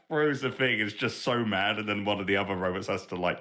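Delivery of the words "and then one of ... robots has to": normal talking voice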